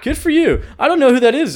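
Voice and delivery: high mock cheering with a laugh; mock Clark. Clark congratulating Lex, high laughing mock voice. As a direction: chirp it out cheerfully. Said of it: "I was like".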